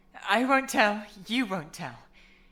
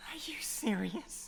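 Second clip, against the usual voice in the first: stunned whisper, much softer; whispered disbelief; 12 dB quieter than usual. Acted. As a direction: breathe it out in shock. Disbelief on realizing the choice.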